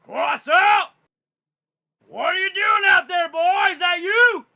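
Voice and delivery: high, loud shout across the path; father calling out. Acted. Father shouting to his son Quetzal from the path, loud and high. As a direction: bellow for the boy like a wounded father. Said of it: "you hear your father go".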